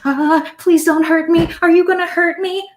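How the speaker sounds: put-on high, frightened child voice; plead, backing away; scared boy. Frightened grandson begs the armed farmer not to hurt him.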